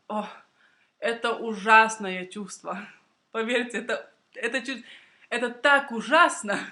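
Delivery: normal talking voice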